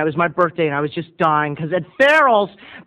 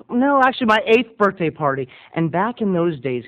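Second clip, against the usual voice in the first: ordinary talk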